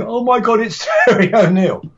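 high-pitched fanboy gushing; childlike starstruck awe. Retold or imitated. Still thrilled after meeting his hero Terry O'Neill, starstruck. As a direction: squeal it like an overawed child, half laughing. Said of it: "I was actually like a child".

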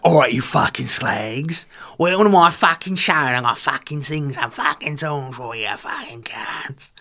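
coarse London-style talking voice, hammed up. Singer greeting her concert crowd, horrendous coarse talking voice. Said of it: "her talking voice, horrendous".

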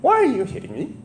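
very high, put-on donkey voice; high-pitched mock plaintive animal voice; talking donkey. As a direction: squeak it out as a comic wronged donkey. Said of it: "what tone the donkey used".

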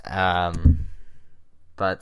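ordinary talk

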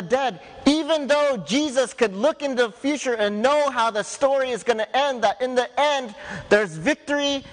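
rising preaching. Acted. Pastor to his congregation about Jesus' victory, rising preaching. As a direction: lift them toward hope, line by line.

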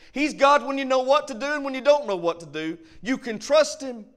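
rising, fervent pulpit voice; preaching. Acted. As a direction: build it up and drive it home to the church. Preacher builds back to exhortation.

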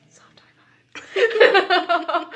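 whispered through a giggle, very slow; laughing whisper. Pretend. Echoing friend's soft high five.

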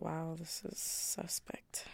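slow whispered aside to herself; slow hushed whisper. Retold or imitated. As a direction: whisper it like a private judgement. Rereading an old journal poem.

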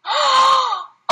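high astonished gasp; sharp gasp. Real, not acted. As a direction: gasp in amazement. Sees the signed book.

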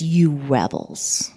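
low, slow, scornful scolding; angry rebuke. Retold or imitated. Moses rebukes the people at the rock, low and slow. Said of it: "He's, he gets angry".

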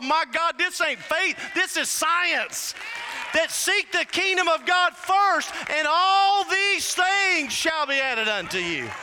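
fired up. Real, not acted. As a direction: proclaim it as a thrilling discovery. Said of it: "Man, I got fired up".

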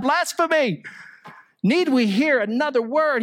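a priest tearing his robe, crying out; outraged cry. Retold or imitated. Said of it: "cries out".

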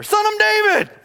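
shouting. Retold or imitated. Blind man yells over the crowd, loud shout. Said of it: "he shouted all the more".

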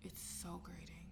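breathy whisper of disgust; whispered, slowed-down complaint. Real, not acted. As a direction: drop to a whisper, slow. Disgusted at a phrase.

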